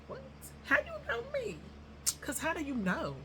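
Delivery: sudden squeaky high disbelief; shocked. Retold or imitated. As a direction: act startled at being recognized. Retelling a fan recognizing her.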